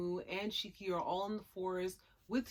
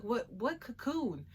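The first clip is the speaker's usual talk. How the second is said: confused surprise; 4 semitones higher, slower than usual. Retold or imitated. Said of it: "everyone's like".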